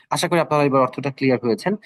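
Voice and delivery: ordinary talk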